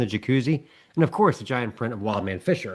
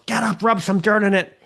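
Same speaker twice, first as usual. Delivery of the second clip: father yelling; 9 semitones higher than usual. Acted. Football dad to his flattened son, yelling. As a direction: yell at your kid to toughen up. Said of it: "the father that yells".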